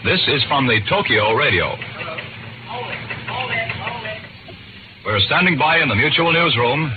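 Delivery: urgent radio news bulletin; news wire bulletin. Acted. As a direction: read it as breaking wartime news. Wartime radio bulletin read.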